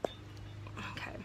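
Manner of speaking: barely audible whisper; retold hushed okay. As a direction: whisper it under your breath. Acting out a retold reaction.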